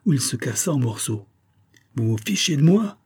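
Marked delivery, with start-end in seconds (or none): 2.0-3.0 high, indignant outburst at the workers; furious cry. A narrator's reading. Pharmacist yells at workmen who broke the crest, higher angry cry. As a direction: snap it at them, outraged. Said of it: "cria le pharmacien furieux".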